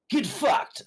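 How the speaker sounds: sudden high yell; shout. Acted. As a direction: bark it at the thugs. Character rebuffs extorting thugs.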